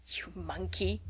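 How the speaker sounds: hushed teasing, under the breath; soft whisper. A narrator's reading. Clover teasing Rose in a hush, whispered. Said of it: "whispered Clover".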